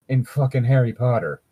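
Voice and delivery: ordinary talk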